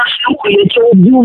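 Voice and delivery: sudden high furious shout; angry yell. Real, not acted. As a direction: scream the threat, losing it. Furious threat during phone argument.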